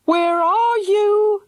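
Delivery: high, slow, loud call. A narrator's reading. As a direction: call out searching. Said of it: "called Missus Love".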